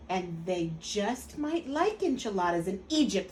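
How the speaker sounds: ordinary delivery, nothing marked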